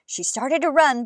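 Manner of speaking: usual speaking voice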